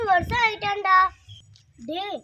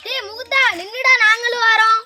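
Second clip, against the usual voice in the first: loud, raised shout to a friend; calling out; 5 semitones higher, 10 dB louder than usual. Acted. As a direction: holler for him to wait up. Calling to friend walking ahead. (உரக்கக் கூப்பிடுதல்)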